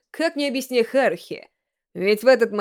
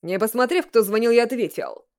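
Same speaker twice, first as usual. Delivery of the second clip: ordinary delivery, nothing marked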